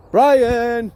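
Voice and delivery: loud shout across the field; calling the dog. Real, not acted. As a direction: yell the dog's name to call him back. Calling his dog on walk.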